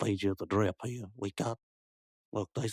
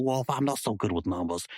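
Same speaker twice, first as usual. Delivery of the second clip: usual speaking voice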